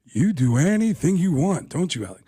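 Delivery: eyeball him and draw it out; Al Pacino impression. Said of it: "Al Pacino's looking at him going".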